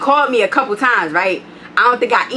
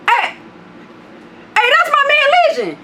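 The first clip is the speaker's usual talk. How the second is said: imitating the man. Neighbor calling out to friend Legion, high mock greeting. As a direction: mimic a stranger's cheerful greeting. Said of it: "He said".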